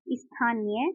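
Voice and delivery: ordinary delivery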